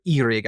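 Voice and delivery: Eirig said with a long EE at the start, EE-rig; corrected pronunciation. Shown as a demonstration. Host offering another way to say the king's name, corrected pronunciation. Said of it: "I guess they, they pronounce it".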